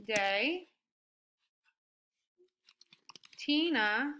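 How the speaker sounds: words drawn out while writing; slow dictation. Shown as a demonstration. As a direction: dictate to yourself slowly. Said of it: "slow dictation while writing".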